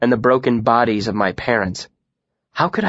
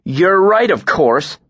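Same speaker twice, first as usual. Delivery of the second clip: loud, high scathing sarcasm; mock agreement with venom; sneering sarcastic concession; 7 semitones higher, 5 dB louder, slower than usual. A narrator's reading. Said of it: "I said scathingly".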